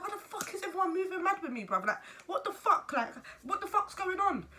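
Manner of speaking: high-pitched, exasperated pleading; paranoid outburst. Retold or imitated. Mother feeling everyone is against her, paranoid high protest. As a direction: protest it high, dragging out the last question. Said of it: "back then I was paranoid".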